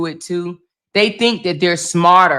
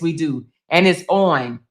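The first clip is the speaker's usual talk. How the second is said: usual speaking voice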